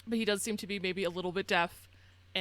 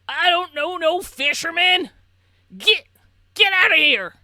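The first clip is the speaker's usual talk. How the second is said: much higher and much louder bellow; furious shout; 10 semitones higher, 15 dB louder than usual. Acted. Old man behind the door shouting strangers away, furious. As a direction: roar him off your doorstep. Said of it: "shockingly loudly".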